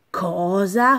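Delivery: usual speaking voice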